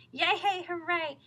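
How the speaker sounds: high, loud cheering yell; crowd yelling. A narrator's reading. Crowd yelling during the parade. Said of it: "the cow kept yelling".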